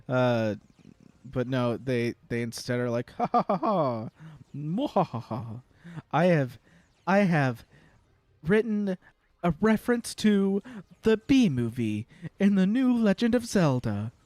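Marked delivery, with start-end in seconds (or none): 3.1-5.4 mad scientist. Retold or imitated. Imitating localizer's evil gloating laugh. 6.1-14.1 high, self-satisfied gloating; smug proud voice. Retold or imitated. Imitating smug proud localizer.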